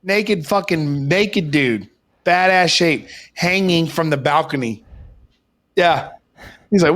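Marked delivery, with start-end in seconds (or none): none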